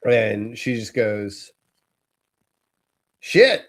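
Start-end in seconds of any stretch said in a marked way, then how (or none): 3.2-3.6 put-on high, loud little-kid exclamation; blurting child. Three-year-old outside a convenience store, high loud blurt. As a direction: imitate a small child blurting it out.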